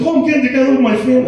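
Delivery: high, fast, chirpy mock of family fans; mock cheerful. Comic mocking family-loving people, high, fast and chirpy. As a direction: send up their cheerful holiday plans.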